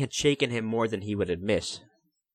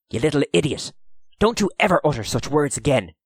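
raised, urgent telling-off; angry scolding; 6 semitones higher, 4 dB louder, faster than usual. A narrator's reading. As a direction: round on the boy in alarm. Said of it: "Dylan rounded on the boy".

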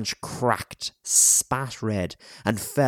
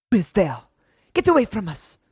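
put-on high, loud frightened-child challenge; girl's voice; 12 semitones higher, 5 dB louder, faster than usual. A narrator's reading. Hidden girl warns a stranger in the dark, high and loud. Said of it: "It was a girl's voice".

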